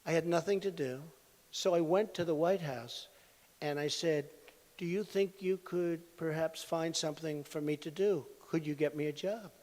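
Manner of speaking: timidly asking for a job, softly imitated; meek and quiet. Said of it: "She said very meekly, very nicely, but meekly".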